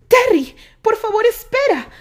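very high, loud pleading shout; shouting after him. A narrator's reading. Candy shouts to Terry from a few metres away, high and loud. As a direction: shout across the distance, begging. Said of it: "le gritó a unos metros".

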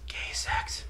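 playful whisper nobody should hear; secretive whisper. Pretend. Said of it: "nobody will even hear us".

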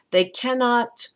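"cannot" hit high, "They" left unstressed; stress on cannot. Shown as a demonstration. Teacher showing the class the line starts on "cannot". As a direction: drop the "They" and lean on "cannot". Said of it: "I'm kinda not reading the they again".

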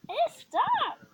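sharp shout at the dog; yelling. Real, not acted. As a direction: yell it, loud and high.

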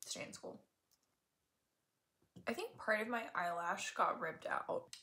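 ordinary talk, nothing marked

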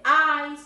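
"eyes" said distinctly as a sample word; model "eyes" pronunciation. Shown as a demonstration. Teacher flags a word for the pupil, careful "eyes". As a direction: sound out "eyes" for the learner. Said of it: "ojo con la pronunciación de".

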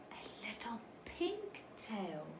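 a gasp, then a breathless whisper. A narrator's reading. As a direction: whisper it in wonder. Gasp at surprise in story.

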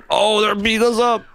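high frantic yells; panicked yelling. Real, not acted. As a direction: yell at them to run, frantic. Panics as raid begins.

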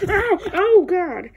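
high, loud cry of pain; startled, hurting outburst; pained yell. Acted. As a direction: yell out as if just hit. Hit during a play fight.

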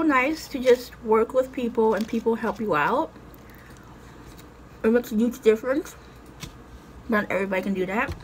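talking with food in mouth; speaking between bites; chewing. Real, not acted. Seen 2: puts chips in mouth at -2 and 15.6, lips chewing.

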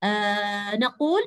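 ordinary delivery

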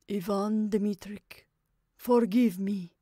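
ordinary delivery, nothing marked

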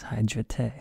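"hydrate" said as hydra-tay; mock foreign ending. Shown as a demonstration. Teacher offers a perfect example, "hydrate" as hydra-tay. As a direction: end "hydrate" in a French-like "tay". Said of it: "We're almost getting French there".

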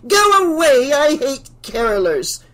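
high, loud shriek over singing; angry shout. A narrator's reading. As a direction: yell furiously to drive them off. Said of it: "Missus Deagle shouted".

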